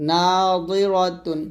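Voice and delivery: নাদিরাতুন slow with a heavy দ; slow heavy dad. Shown as a demonstration. Teacher demonstrating heavy দ slowly. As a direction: drawl নাদিরাতুন with full দ. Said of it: "দজের দই".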